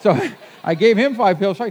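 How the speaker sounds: laughing loudly mid-line; loud laugh breaking into words. Real, not acted. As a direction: laugh out the punchline. Laughing while telling.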